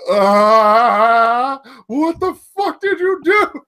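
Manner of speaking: high-pitched mock horror, wailing; hammed-up appalled outcry; Kurt Angle. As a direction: gape aghast, then screech in disbelief. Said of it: "with his jaw agape and his arms".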